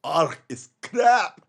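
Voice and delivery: slow, loud mock Scottish accent from The Simpsons; a hammed-up Scottish growl, borrowed from TV; exaggerated cartoon Scottish accent. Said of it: "How you like my Scottish accent?".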